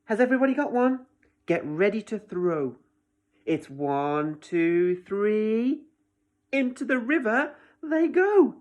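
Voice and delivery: loud, fast, eager countdown; excited race call. A narrator's reading. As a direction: call out the race like a keen child. Girl starts the stick race.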